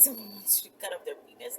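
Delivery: usual speaking voice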